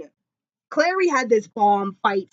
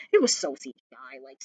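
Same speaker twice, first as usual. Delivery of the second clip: normal talking voice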